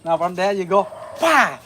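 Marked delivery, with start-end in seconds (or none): 1.2-1.6 sharp shouted bah on the strike; kiai shout. Shown as a demonstration. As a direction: shout bah as the punch lands. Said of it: "See the hip".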